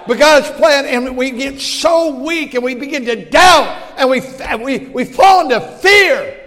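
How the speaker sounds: loud, high fiery sermon; preaching at full force. Acted. Preacher exhorting congregation on God's plan, shouting. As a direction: thunder it at the pews.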